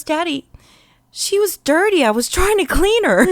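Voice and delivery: put-on high, innocent explaining child; a toddler justifying himself sweetly; little boy voice.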